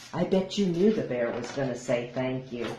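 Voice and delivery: ordinary delivery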